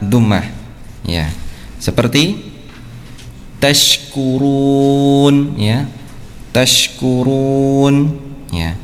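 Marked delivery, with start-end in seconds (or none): 3.6-5.5 tashkurun slow, ra said thick and full; slow Quran-style tashkurun; heavy ra. Shown as a demonstration. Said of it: "ra-nya dibaca tebal". 6.5-8.2 tashkurun slow, ra said thick and full; slow Quran-style tashkurun; heavy ra. Shown as a demonstration. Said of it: "ra-nya dibaca tebal".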